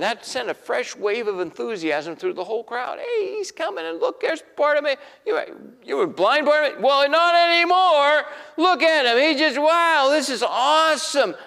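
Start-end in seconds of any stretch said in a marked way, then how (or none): 3.0-5.0 much higher, calling out to the crowd in delight; excited crowd call. Acted. Crowd members spotting healed Bartimaeus, excited calling out. 5.2-11.4 much higher and louder, bursting with wonder; amazed shout. Acted. Crowd marveling at the healed blind man, amazed shouting.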